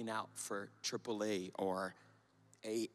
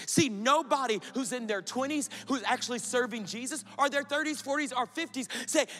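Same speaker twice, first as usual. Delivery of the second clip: rising, fervent preacher's call; preaching; 11 semitones higher, 9 dB louder than usual. Acted. Preacher urging young listeners to serve God early, fervent preaching. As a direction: build it up like a pulpit appeal.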